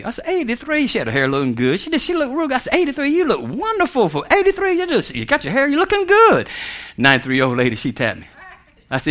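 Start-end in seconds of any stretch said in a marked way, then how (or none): 0.3-0.9 high, soft incredulous question; mock amazement. Waiter flattering an old lady on her age, mock amazement. 2.7-6.5 high, swooping mock admiration; gushing flattery. Waiter flattering an eighty-three-year-old customer, gushing admiration.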